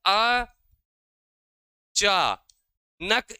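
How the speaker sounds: अ, चा, नक with long pauses between; syllable by syllable. Shown as a demonstration. Teacher showing the syllables of अचानक, pausing after each. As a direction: sound out अ चा नक one syllable at a time. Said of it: "एक साथ बोलते हैं".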